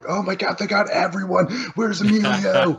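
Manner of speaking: high, giddy outburst at the screen; high-pitched mock fan excitement; excited fan reaction. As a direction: gush in a high voice like an overexcited viewer. Acting out own movie-watching reaction.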